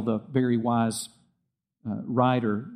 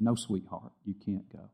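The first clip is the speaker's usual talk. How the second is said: much softer, lower, tender refusal; gentle and kind; 6 semitones lower, 9 dB quieter than usual. Retold or imitated. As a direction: let her down gently as a loving father. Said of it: "very gently and kindly said".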